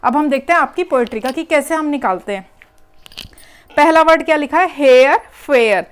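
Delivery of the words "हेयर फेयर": हेयर फेयर slow and loud, ए vowel drawn out; teacher sounding out हेयर फेयर slowly; emphasised rhyme sound. Shown as a demonstration. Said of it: "ए की sound आ रही है".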